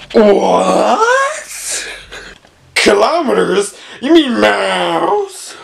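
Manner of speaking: high, slow disbelief; incredulous. Pretend. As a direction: act shocked at the idea. Mock outrage at units.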